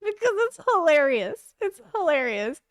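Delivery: high laughing. Real, not acted. Laughing while telling why it was hilarious. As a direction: let the laugh carry it up.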